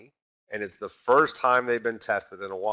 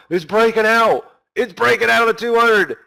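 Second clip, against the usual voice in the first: higher and louder, hyped-up trader calling a breakout; mock excited yelling; 9 semitones higher, 5 dB louder than usual. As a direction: shout it like a trader who thinks it's breaking out. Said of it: "traders start yelling".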